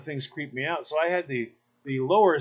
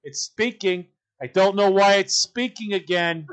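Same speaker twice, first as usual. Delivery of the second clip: louder, scornful mock mother; dismissive mother; 4 semitones higher, 6 dB louder than usual. Mother of the bride scoffs at her daughter, scornful. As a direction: sneer at the bride like an object. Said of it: "have heard them say".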